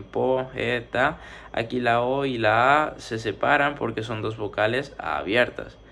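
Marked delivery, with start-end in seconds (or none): 0.0-1.2 "po, e, ta" slowly, one syllable at a time; dividido em sílabas. Shown as a demonstration. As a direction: break "po, e, ta" into three separate beats. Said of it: "se divide en".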